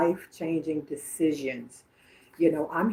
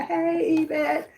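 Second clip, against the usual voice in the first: spunky hello, pitched way up; 12 semitones higher, 3 dB louder, slower than usual. Retold or imitated. Medium repeating grandmother's hello to Yvette, spunky. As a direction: sing out a high, spunky greeting. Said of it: "a real spunky type of energy".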